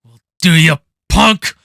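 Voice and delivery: loud, hard delivery of the threat; loud, serious tough-guy challenge; serious Clint Eastwood line. Retold or imitated. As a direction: punch it out like a cop at the end of a hunt. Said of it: "where it's very serious".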